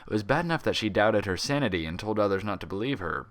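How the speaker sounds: ordinary delivery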